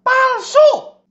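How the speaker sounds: very high, loud devil voice; very high, loud cartoon devil; squeaky devil verdict. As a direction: squeal the word out.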